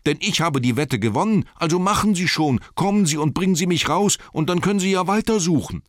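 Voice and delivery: high, loud, urgent demand; calling out insistently. A narrator's reading. Bilbo demands to be led out as promised, insistent call. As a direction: press your claim across the dark. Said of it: "rief Bilbo".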